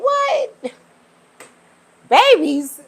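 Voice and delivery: high, loud incredulous yelp; stunned, loud double take; shocked disbelief. Real, not acted. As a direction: react like you can't believe it. Shocked at eating baby animals.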